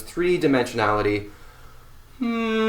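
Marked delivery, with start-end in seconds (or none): 2.2-2.7 a high, soft hum; soft humming in the head. Shown as a demonstration. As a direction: let the hum float up into the skull. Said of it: "draw those vibrations out".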